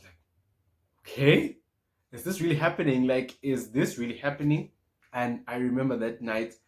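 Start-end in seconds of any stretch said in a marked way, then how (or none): 1.0-4.7 higher, louder incredulous questioning; can't believe the good news, voice jumping up; thrilled, astonished double-take; excited disbelief. Retold or imitated. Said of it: "and I was so excited".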